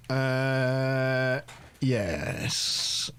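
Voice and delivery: ordinary talk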